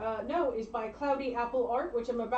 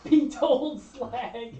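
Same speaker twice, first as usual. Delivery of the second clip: mock Manx retort; 8 dB louder, much slower than usual. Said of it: "echoes the quote".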